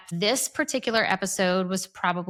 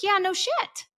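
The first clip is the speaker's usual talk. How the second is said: high rising no shit; exasperated; 12 semitones higher than usual. Real, not acted. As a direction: let it shoot up in disbelief. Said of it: "repeated exasperation".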